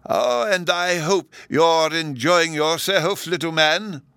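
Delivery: high, drawn-out professor voice; genial old professor. A narrator's reading. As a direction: address a little child slowly, pitched high. Professor greets child in story.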